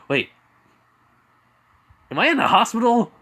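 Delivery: high, loud, quick question; alarmed realization. Acted. Izuku realizes where he is.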